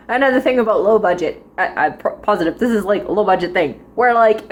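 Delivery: ordinary talk, nothing marked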